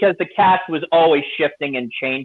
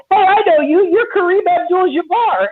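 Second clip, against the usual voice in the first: put-on much higher, louder kid recognising a star; excited kid; 13 semitones higher, 3 dB louder than usual. The kid spots Kareem in the cockpit, in a high, louder starstruck voice. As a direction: squeal it like a starstruck boy. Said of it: "the kid's saying".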